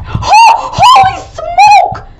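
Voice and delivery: very high, loud yelp of shock; gasping high-pitched scream; startled shriek. Real, not acted. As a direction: shriek it as if jumped by a monster. Startled by the beast nearby.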